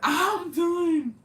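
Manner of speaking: high, loud drawn-out exclamation; loud high-pitched wail of amusement; amused outburst. Real, not acted. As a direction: squeal it out, amused and overcome. Amused outburst at viewer's comment.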